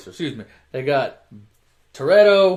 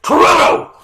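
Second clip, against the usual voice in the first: loud, high mock holler; imitated police shout; 11 semitones higher, 9 dB louder than usual. Cop pulls up on walking suspect, loud mock shout. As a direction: holler the name like a cop calling out.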